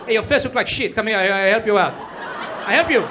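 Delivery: put-on pushy Israeli mall seller accent, half laughing; hammed-up broken-English Israeli hawker, insulting then selling; mock Israeli kiosk seller, laughing through it; Israeli salesman accent. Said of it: "It's like".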